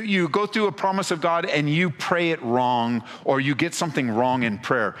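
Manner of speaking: ordinary talk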